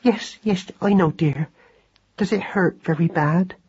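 gentle anxious sympathy; tender concern. Acted. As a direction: humour and comfort him gently. Wife soothes injured husband.

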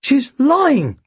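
high, urgent accusation; high-pitched indignant outburst; exclaiming. A narrator's reading. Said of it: "Tim exclaimed".

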